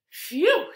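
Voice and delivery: high, loud puff of relief; relieved phew. A narrator's reading. Tortoise creeps out of her shell, loud relief. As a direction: let it out as a whistly exhale.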